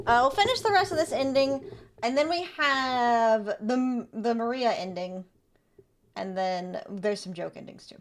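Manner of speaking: ordinary talk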